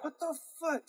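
high, hushed disbelief; stunned curse under breath. Retold or imitated. Driver gone, disbelief.

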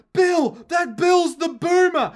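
high, loud mock cry of the wife; frantic wife. The wife telling the police her husband is the boomer, high and loud. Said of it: "is like".

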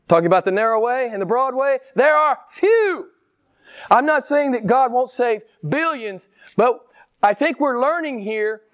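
high-pitched pulpit insistence; emphatic preaching. Real, not acted. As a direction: press the point to the congregation, pitched high. Preaching with rising force.

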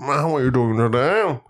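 put-on British English; proper British accent. Shown as a demonstration. Showing his co-host an English accent, proper British accent. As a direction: introduce yourself in mock British. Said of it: "Unless I do a proper British accent".

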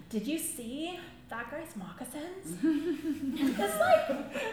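slow, giggly crush talk; gossiping teenage girl. Retold or imitated. Teenage girls talking about their crushes, giggly. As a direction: draw it out, giggling over a crush. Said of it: "talking about their crushes".

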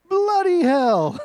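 mock British outburst, pushed up high. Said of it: "if you do it in a British accent".

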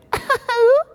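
high squealing baby laugh imitated; a grown man's high-pitched mock baby giggle. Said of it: "and the baby went".

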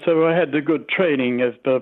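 ordinary talk, nothing marked

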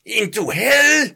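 slow shouted question; priest shouting. Acted. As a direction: bellow it like an exorcist. Priest voiced in exorcism.

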